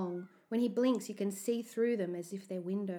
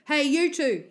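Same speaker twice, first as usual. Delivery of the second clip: loud, raised guard's challenge; calling out; 7 semitones higher, 11 dB louder than usual. A narrator's reading. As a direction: hail them sharply from above. Security guard calls out to boys.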